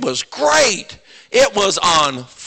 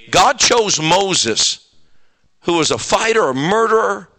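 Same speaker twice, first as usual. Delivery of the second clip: ordinary talk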